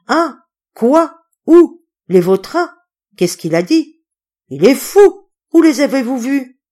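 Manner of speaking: high, loud startled cries; panicked questioning. A narrator's reading. Panicked crowd questions the doctor.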